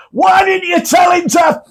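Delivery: high shout across the water; retold shout. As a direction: call it out from a passing boat. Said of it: "he just shouts".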